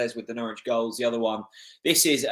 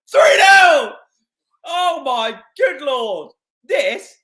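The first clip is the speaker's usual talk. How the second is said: full-throated, much higher and louder goal scream; goal celebration; 13 semitones higher, 13 dB louder, slower than usual. Real, not acted. Fan screaming as his team scores the third, a real roar. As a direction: let it rip as the ball hits the net.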